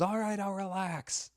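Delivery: mock the comic back; mocking fan reply. Front-row fan talking back to the comic, high and mocking.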